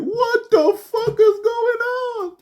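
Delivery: high, slow mock disbelief; shocked Job. Job hearing his kids died, high and shocked. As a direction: stretch it out in hammed-up shock. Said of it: "Job was like".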